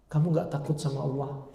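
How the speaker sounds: softly, pleading woman's rebuke; woman quietly rebuking the man in the story; quiet plea. Retold or imitated. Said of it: "wanita ini berkata".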